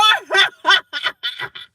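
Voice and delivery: loud high cackle; shouted laugh. Real, not acted.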